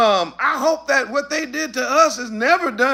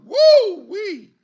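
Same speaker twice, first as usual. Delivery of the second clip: excited whoop; 10 semitones higher, 5 dB louder than usual. Real, not acted. Preacher exclaiming.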